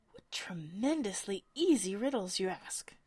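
loud, scornful character growl; a gruff, loud Humpty Dumpty scoffing. Acted. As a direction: growl it out in disdain. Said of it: "growled out".

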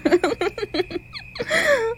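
high, gloating cackle; villain's laugh. Acted. Killer gloating over a captive, high cackle.

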